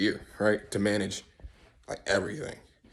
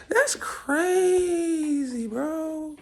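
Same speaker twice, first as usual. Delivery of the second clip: very slow, high, stretched-out awe; high-pitched amazement; 12 semitones higher, 3 dB quieter, much slower than usual. Acted. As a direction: draw it out in a squeaky marvel. Repeats mock amazement.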